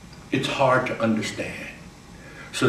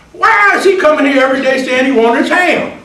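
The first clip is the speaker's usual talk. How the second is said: higher, louder, baffled shout; a bystander yelling in disbelief; exasperated shout; 12 semitones higher, 9 dB louder than usual. Acted. Bystander shouting about Hambone, baffled. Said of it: "somebody says".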